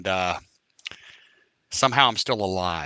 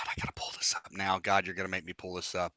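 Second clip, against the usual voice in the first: whisper; 5 semitones lower, 5 dB quieter, faster than usual. Real, not acted. Muttering to himself while searching.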